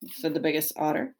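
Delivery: ordinary delivery, nothing marked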